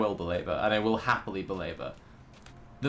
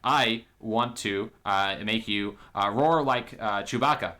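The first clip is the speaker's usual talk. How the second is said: halting, awkward suitor act; Ben Shapiro; 2 semitones lower than usual. Acted. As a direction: stumble through it with constant ums and uhs. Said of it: "Ben Shapiro walks in and goes".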